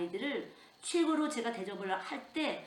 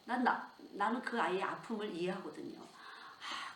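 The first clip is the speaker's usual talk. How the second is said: usual speaking voice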